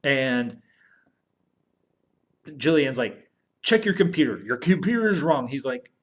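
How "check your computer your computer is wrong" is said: higher, insistent retold demand; lightly acted challenge, pitched up; mock-indignant correction. Quoted character challenges the doctor.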